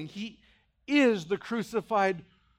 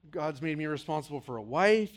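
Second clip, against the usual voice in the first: normal talking voice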